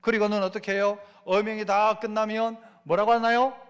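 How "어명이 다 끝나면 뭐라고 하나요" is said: ordinary talk, nothing marked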